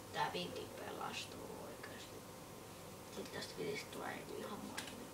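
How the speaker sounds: voiceless, hushed words; whisper. Real, not acted. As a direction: whisper it under your breath. Streamer whispers while playing.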